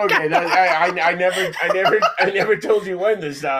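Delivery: high, laughing protest. Real, not acted. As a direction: protest through the giggles. Laughing at cohost's reading.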